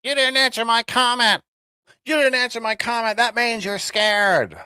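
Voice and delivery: high, whiny mock of an angry commenter; mocking troll voice. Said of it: "I've heard that for five years".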